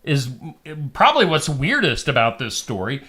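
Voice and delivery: ordinary talk, nothing marked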